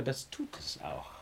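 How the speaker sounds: almost whispered, breathy and soft; hushed repeat. Acted. Holmes softly repeating it to the inspector, near whisper. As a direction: let it drop to a near whisper.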